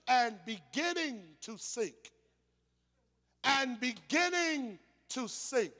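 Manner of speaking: "beginning" stretched slowly, falling into "to sink"; slow, deliberate weight on "beginning"; stress on beginning. Shown as a demonstration. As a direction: dwell on "beginning", pause, then "to sink". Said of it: "look at that scripture".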